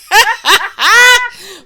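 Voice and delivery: squealing burst of laughter; loud high laugh. Real, not acted. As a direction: shriek with laughter. Cracking up mid-reading.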